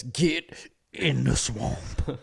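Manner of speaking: put-on very slow, drawn-out Louisiana accent; mock Louisiana accent. Quoting the only line they can do, slow mock Louisiana accent. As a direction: ham up a swamp-country Louisiana accent. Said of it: "All I can say is".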